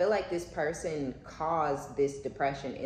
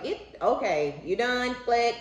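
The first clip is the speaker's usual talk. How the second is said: curt hang-up; 8 semitones higher, 5 dB louder than usual. Retold or imitated. Hanging up on yeller.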